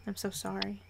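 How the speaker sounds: soft, mournful apology; quiet apology. Acted. Mourning the dead in story.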